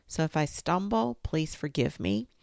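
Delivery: ordinary delivery, nothing marked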